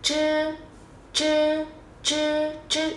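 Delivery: "chư" tròn miệng, lưỡi cong; "chư" không bật hơi, lưỡi cuộn; tiếng Trung zh. Shown as a demonstration. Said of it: "hít nào". ("chư" cong lưỡi không bật hơi)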